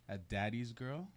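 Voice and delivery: ordinary talk, nothing marked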